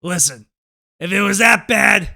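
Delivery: a mock gruff shout from the dad; blunt dismissive retort. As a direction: bark back at the worried daughter, unbothered. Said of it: "without missing a beat, just says".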